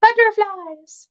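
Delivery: very high, slow, sing-song Butterflies; cooing. Real, not acted.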